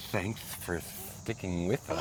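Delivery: mock the hissing s sounds; drawn-out s like a snake. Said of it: "You're like".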